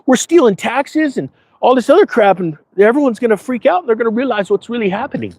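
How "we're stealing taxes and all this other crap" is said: high, shouted whoa dude; hammed-up panic over losing the money scheme; mock Democrat outrage. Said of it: "They're like".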